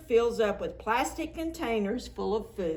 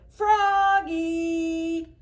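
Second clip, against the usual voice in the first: a louder summoning call; 8 semitones higher, 6 dB louder than usual. Acted. Principal calling Froggy off the bus, loud call. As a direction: call him over firmly.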